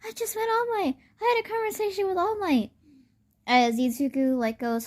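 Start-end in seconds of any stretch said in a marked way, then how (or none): 0.0-2.7 high squealed fanboy news; super excited. Retold or imitated. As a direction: burst out with it, pitched way up. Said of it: "rushes home super excited".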